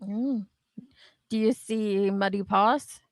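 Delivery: ordinary talk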